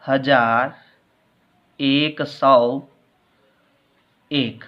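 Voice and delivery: slow, word by word, with long gaps; slow dictation. Shown as a demonstration. Teacher dictating the number word by word while writing it. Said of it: "शब्दों में लिखेंगे".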